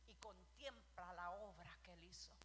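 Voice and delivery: hushed, breathy whisper, slow; whispered awe at God's rest. Acted. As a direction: drop to a reverent whisper. Preacher hushes on God resting.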